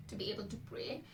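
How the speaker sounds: soft, voice failing on the phrase; voice giving out. Real, not acted. Speaker admitting prayers need work, voice giving out. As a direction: let the voice falter and drop away.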